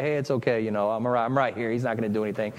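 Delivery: reassuring husband. Retold or imitated. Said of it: "does Joseph say".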